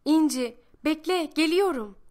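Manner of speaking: put-on girl running, calling to İnci; child calling out. A narrator's reading. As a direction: shout it as she runs behind. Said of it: "Bağırıyorum bir yandan da".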